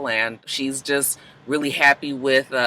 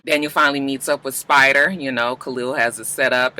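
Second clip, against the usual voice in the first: normal talking voice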